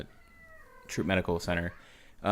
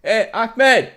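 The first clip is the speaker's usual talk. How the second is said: much higher and louder, hammed-up foreign fighter; mock insurgent; 12 semitones higher, 9 dB louder than usual. Insurgent calling to Ahmed about shirtless soldier, hammed-up high shout. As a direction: ham up an excited enemy fighter calling to a buddy.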